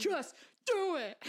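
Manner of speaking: slow, intense Shia LaBeouf voice; slow, urgent motivational Shia LaBeouf; Shia LaBeouf impression. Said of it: "Freaking Shia".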